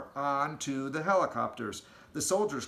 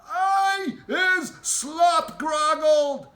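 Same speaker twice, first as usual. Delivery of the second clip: very slow, much higher, loud squawk; squawking giant; 10 dB louder, much slower than usual. Acted. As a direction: squawk it out like a huge angry bird. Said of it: "squawked the gizzard gulper".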